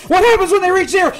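the fear boils over on the third try; panicked repeat. Real, not acted. Numbers counting down, growing panic.

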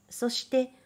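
ordinary delivery

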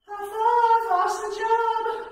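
high, loud celebratory praise; excited cheer. Real, not acted. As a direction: cheer her on with a big high five. Cheering on her mom.

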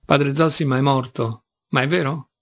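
plain reading voice

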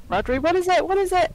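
high, urgent, rising alarm; alarmed questioning. Acted. Character asks horrified Rodri what he sees, alarmed. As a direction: ask urgently, pitch climbing on the repeat.

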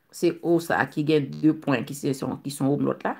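normal talking voice

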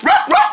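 high pretend woof; playful dog bark. Voicing the dog who is glad it's over, high playful barks. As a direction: yap like a little dog.

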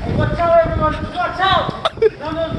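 loud high warning call; shouting warning. Real, not acted. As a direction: yell to clear the way. Shouting at everyone.

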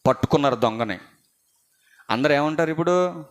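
usual speaking voice

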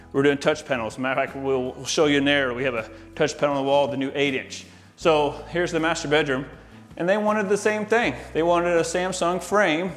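ordinary talk, nothing marked

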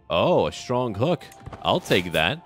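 usual speaking voice

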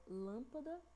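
lâmpada with LÂM drawn out; stress on first syllable. Shown as a demonstration. Teacher showing the tonic syllable, lâmpada stressed on LÂM. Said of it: "a sílaba que tá puxando mais é a lã".

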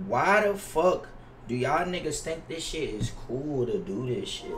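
ordinary delivery

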